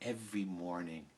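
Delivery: plain reading voice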